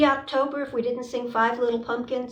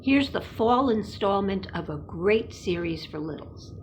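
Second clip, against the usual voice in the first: usual speaking voice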